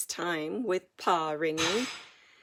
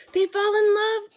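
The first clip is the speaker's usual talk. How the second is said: high, loud, delighted cry; excited exclamation; 12 semitones higher, 11 dB louder than usual. A narrator's reading. Girl guessing the romance, crying out excitedly. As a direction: burst out with joy. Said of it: "Penelope exclaimed".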